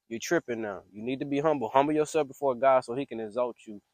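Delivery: usual speaking voice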